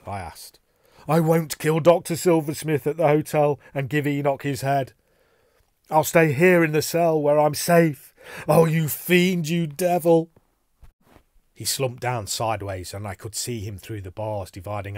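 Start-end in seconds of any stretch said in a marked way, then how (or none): 1.1-10.3 loud, high, anguished outburst; frantic raised voice, ending in a dragged-out curse; hysterical defiance. A narrator's reading. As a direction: cry out in terror and refuse. Hysterical man refusing and cursing Enoch.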